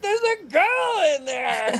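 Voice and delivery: high, slow alarmed cry; mock panic, voice jumping up; panicked cry. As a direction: shout it out in alarm as the car gets towed. Panicked cry at towed car.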